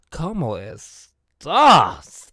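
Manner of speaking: very slow, loud, stretched 'estás'; milk every syllable, landing loud; diva catchphrase. Shown as a demonstration. Host recalling how they said it, drawn-out diva attitude. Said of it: "No, I said".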